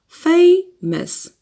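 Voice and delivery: "Famous" split in two, first syllable high. Shown as a demonstration. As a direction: punch the first syllable of "Famous", pause, then the second. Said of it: "Two syllables".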